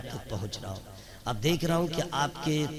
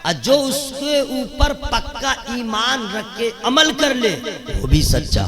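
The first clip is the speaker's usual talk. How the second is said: loud, high, slow climax; rousing preacher at full stretch; impassioned preaching; 11 semitones higher, 11 dB louder, slower than usual. Real, not acted. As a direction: build each phrase to a ringing cry. Climax of the sermon.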